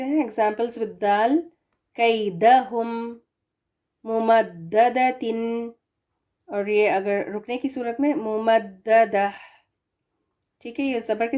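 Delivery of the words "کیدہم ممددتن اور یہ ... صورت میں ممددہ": slow, drawn Quranic recitation. Acted. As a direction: recite each word slowly and clearly.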